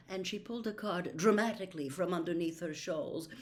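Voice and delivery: usual speaking voice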